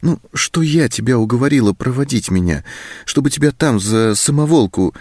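выше голосом, просительно; объясняй, упрашивая его; уговаривает. A narrator's reading. Зоська продолжает уговаривать Антона. (просительно, повышенным голосом)

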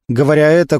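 ordinary delivery, nothing marked

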